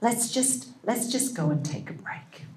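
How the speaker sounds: gentle whispered suggestion; soft whisper. Shown as a demonstration. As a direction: breathe it out softly to calm the child. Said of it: "and you use a soft voice".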